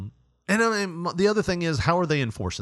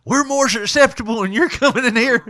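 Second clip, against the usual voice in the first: very high, loud, fast cackling threat; creepy old person voice; 11 semitones higher, 7 dB louder than usual. Pretend. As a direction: squeal it like a creepy crone. Voicing old people threatening.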